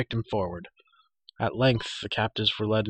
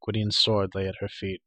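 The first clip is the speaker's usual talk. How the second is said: ordinary delivery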